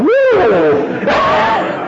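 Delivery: high-pitched drawn-out whoop; shout. A narrator's reading. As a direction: shout out a long, high "woo". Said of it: "Claude shout".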